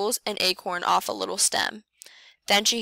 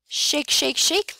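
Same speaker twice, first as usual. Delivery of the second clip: rising higher and louder on each shake; lively shaking; 7 semitones higher, 3 dB louder, slower than usual. A narrator's reading. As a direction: shake the words out with bounce. Bear shaking branches.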